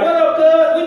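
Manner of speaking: street greeting. Retold or imitated. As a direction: greet God like a homeboy. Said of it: "If you talk to Jesus like this".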